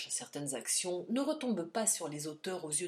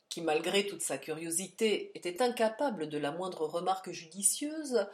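plain reading voice

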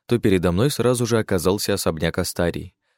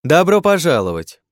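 loud welcome; 8 semitones higher, 6 dB louder than usual. A narrator's reading. Astaria calls out loud.

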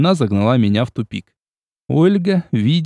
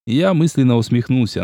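ordinary delivery, nothing marked

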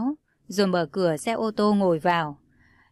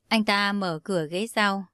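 plain reading voice

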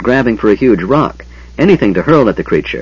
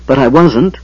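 higher-pitched defiant retort; 5 semitones higher, 4 dB louder than usual. A narrator's reading. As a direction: fire it back in defiance. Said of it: "Lorana cried defiantly".

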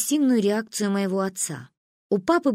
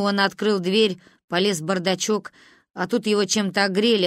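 usual speaking voice

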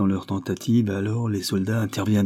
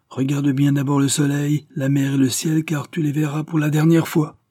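high, raised-voice menace; acted defiant warning, pitched up; threat; 7 semitones higher, 4 dB louder than usual. A narrator's reading. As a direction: warn him grimly from cover. Kulo threatens the approaching sheriff.

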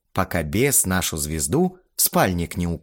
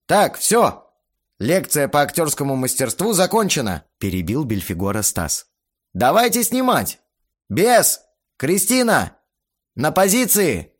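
higher, louder commanding director; brisk director voice; 6 semitones higher, 4 dB louder than usual. A narrator's reading. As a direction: cut him off, call places. Stas cuts him off.

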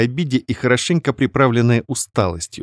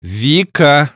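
a touch of a growl, louder; mock growl; 5 semitones higher, 4 dB louder than usual. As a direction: growl her name in warning. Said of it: "зарычала я". (зарычала, сдержанно)

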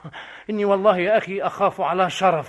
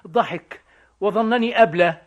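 ordinary delivery

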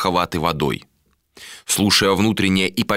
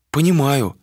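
higher female reply; lightly voiced woman; 6 semitones higher than usual. A narrator's reading. Woman claims to understand, lightly voiced higher reply. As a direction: answer a little higher, as her.

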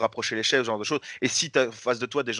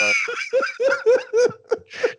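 big high burst of laughter; loud high laugh; 7 dB louder than usual. Real, not acted. After the Jean-Luc joke.

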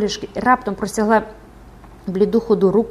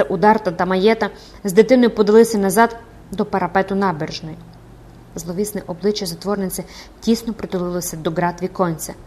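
ordinary delivery, nothing marked

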